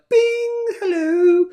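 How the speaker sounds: very high, loud, sing-song chime; ping awake like a little bell; perky wake-up. Acted. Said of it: "I wake up like".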